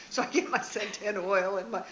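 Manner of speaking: ordinary talk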